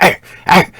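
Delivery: loud, high playful barking; toddler dog bark. Retold or imitated. Grandson barking back at the big dog, loud playful barks. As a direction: bark back like a little kid.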